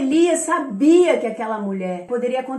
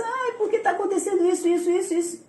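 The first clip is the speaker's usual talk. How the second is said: high, quick mock whine; fussy, raised-pitch mimicked lament; imitated complaining; 6 semitones higher, faster than usual. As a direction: parody someone rattling off woes. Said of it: "a pessoa chega e começa".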